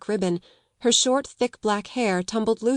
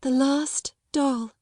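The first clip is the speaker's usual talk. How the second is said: slow, higher, hushed grief; mournful; 6 semitones higher, slower than usual. A narrator's reading. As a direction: say it slowly like a lost child. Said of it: "her little mournful voice had an odd sound".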